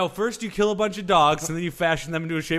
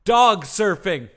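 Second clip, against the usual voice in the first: big reveal; 2 semitones higher, 8 dB louder, slower than usual. Acted. Announcing weird sport reveal.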